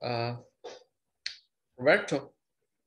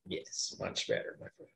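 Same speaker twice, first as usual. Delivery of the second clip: soft praise; 12 dB quieter than usual. Real, not acted. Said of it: "praising student".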